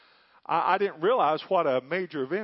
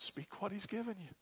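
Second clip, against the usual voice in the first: "Speak what he's given you" said much softer, hushed as if into an ear; retold whisper; 3 semitones higher, 13 dB quieter than usual. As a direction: coax him quietly, almost a whisper. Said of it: "I whispered in his ear".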